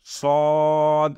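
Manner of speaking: "সদ" with a long, level rounded vowel; careful pronunciation. Shown as a demonstration. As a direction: hold the middle of "সদ" slowly and steadily. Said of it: "সুন্দরভাবে উচ্চারণ করার চেষ্টা করব".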